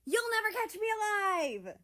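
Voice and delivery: high, loud playful yelling; mock chase shout. America yelling after the wallet thief.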